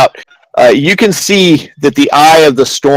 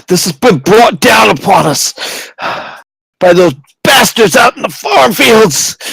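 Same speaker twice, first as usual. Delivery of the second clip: high, strained, agonised outpouring; desperate magistrate; 8 semitones higher than usual. Acted. Magistrate warning he can't hold the shield, desperate strain. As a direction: plead at the end of your strength. Magistrate despairing under the storm.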